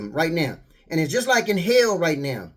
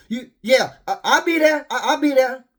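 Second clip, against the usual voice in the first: eager worker; 5 semitones higher than usual. Retold or imitated. Answering boss about overtime.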